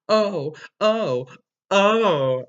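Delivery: loud hammed-up moaning oh; mock moans. Reading a fanfic sex scene aloud, hammed-up moans. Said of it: "I screamed".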